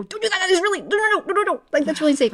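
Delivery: high, frantic inner alarm; panicky brain voice. Retold or imitated. Brain voice warning her against crying, high and frantic. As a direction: play the brain yelling no in a panic. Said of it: "I feel my brain go".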